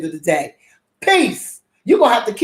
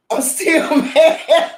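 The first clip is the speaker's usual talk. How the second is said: drawn out very slowly with a laugh in it; slow, higher, laughing "still mad"; 6 semitones higher, much slower than usual. Real, not acted. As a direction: crack up while insisting you're mad. Laughs through repeating it.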